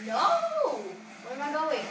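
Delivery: sudden high, loud cry; panicking yell. Real, not acted. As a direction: yelp in alarm at the crash. Crash, loses direction.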